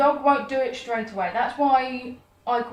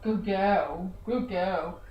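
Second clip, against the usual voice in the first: lower, softer praise for contrast; low-pitched praise; 5 semitones lower, 4 dB quieter, much slower than usual. Shown as a demonstration. Trainer showing the dog a lower voice. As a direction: drop the pitch to show what fails. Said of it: "than they do low-pitched".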